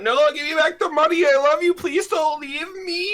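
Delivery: hammed-up girlfriend begging not to be dumped; mock pleading ex. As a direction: ham up the desperate ex. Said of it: "And she went".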